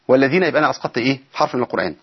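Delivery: ordinary talk, nothing marked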